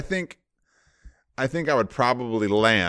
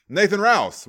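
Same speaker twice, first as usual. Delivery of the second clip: mock host call; 11 semitones higher, 6 dB louder than usual. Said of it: "You're like".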